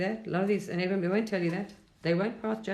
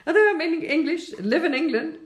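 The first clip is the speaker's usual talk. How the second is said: loud, high-pitched laughing delivery; 10 semitones higher, 6 dB louder than usual. Real, not acted. English speaker treated as foreign at home, laughing. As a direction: let laughter push the words up high and loud.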